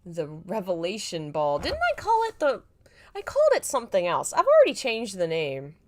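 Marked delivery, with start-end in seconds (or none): 1.6-5.7 surprised. Real, not acted. Reader exclaims over forgotten name.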